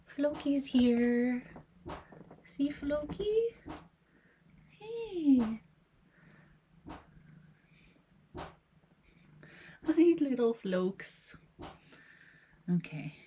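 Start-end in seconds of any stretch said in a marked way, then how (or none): 0.0-11.4 pet voice. Real, not acted. Owner greeting Floki on the desk, in slow high baby talk. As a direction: coo slowly up high at the cat. Cooing at cat.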